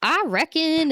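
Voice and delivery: high, loud hayseed drawl; playful mock yokel voice; country bumpkin. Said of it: "a country bumpkin, you know, like".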